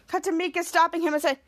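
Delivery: ordinary delivery, nothing marked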